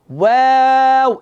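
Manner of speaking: ওয়াও drawn out slowly, lips round first; slow ওয়াও from round to spread lips; waw drill. Shown as a demonstration. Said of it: "মুখটা গোল হবে প্রথমে".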